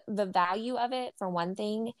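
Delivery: ordinary talk, nothing marked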